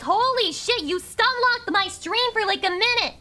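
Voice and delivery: loud, high-pitched rant at chat; slow, emphatic angry shout; exasperated scolding. Real, not acted. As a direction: yell at them for spamming. Scolding chat for emote spam.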